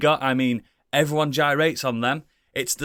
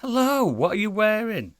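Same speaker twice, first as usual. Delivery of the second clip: gushing red carpet chatter; 8 semitones higher than usual. Pretend. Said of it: "red carpet interviewer".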